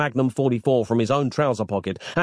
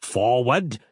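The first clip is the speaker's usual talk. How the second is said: ordinary delivery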